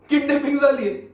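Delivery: loud, high-pitched startled question; shocked. Acted. Inspector reacting to a caller's kidnapping report, shocked. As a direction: blurt it out in alarmed disbelief.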